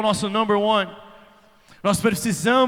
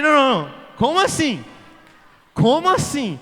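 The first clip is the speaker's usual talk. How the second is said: higher, louder, baffled disbelief; incredulous protest; 6 semitones higher, 5 dB louder than usual. Retold or imitated. Man protesting to crying girlfriend, incredulous and raised. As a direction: demand an explanation, stunned and rising.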